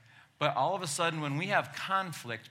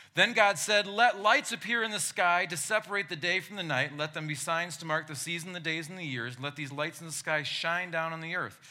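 loud, high preacher reading scripture; booming biblical narration; 4 semitones higher, 4 dB louder than usual. Acted. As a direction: proclaim it like from the pulpit. Reads Genesis aloud.

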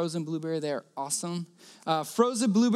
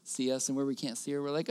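ordinary talk, nothing marked

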